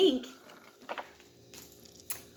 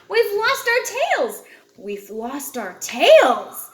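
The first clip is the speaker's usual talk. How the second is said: loud, high cheer, second line slower; 3 semitones higher, 7 dB louder than usual. Acted. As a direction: cheer it out with joy. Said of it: "they cheered".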